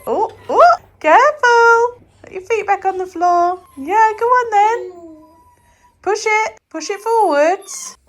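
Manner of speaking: high, loud cheering-on. Real, not acted. Mum urging baby to push the walker, high excited encouragement.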